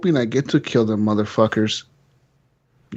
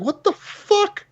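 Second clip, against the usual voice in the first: high, slow squeal of disbelief; shocked disbelief; much slower than usual. Retold or imitated. Gamer betrayed by general, shrieking stunned disbelief. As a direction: shriek it like you just got betrayed.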